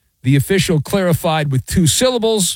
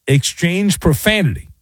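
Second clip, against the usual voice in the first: normal talking voice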